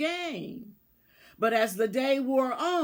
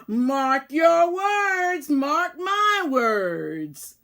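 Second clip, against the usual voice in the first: slow, much louder, higher warning; furious slow warning from a soaked mouse; glaring warning; 4 semitones higher, 6 dB louder, much slower than usual. A narrator's reading. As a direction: spit it out slowly, glaring. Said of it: "she just glared and said".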